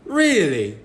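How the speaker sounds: imitated curious British stranger; British-accented question, lifting high. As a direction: quiz someone in a put-on British accent. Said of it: "expect them to have an accent".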